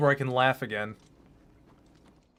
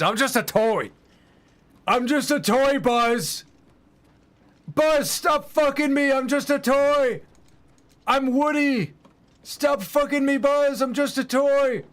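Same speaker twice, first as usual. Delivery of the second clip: Woody voice; 8 semitones higher than usual. Pretend. Streamer acts out a Woody and Buzz skit, high whiny plea. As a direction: plead like a helpless toy, pitched up. Acting out Woody being harassed.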